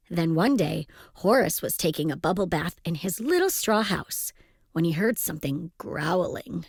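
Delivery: ordinary delivery, nothing marked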